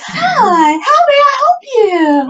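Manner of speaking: high bright customer service voice; chirpy cashier greeting with pitch jumped way up; drive-through voice. Retold or imitated. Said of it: "what I call the drive-through voice".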